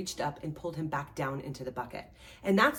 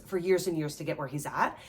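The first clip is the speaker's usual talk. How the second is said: normal talking voice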